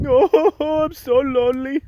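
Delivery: high whiny lonely sigh; mock whining. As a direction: whine like a sad puppy.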